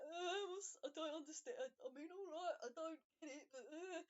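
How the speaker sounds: high, loud, near-crying bewilderment; flustered whimper. Acted. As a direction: babble in wounded confusion. Mo confused, near tears.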